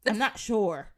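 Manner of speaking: ordinary delivery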